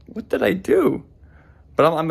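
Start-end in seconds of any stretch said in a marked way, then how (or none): 0.1-1.0 confused. Retold or imitated. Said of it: "and I'm like".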